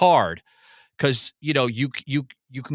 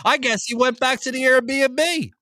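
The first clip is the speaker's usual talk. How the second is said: exasperated inner rant; 8 semitones higher than usual. Retold or imitated. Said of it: "I'm like".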